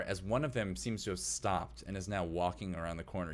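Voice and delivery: normal talking voice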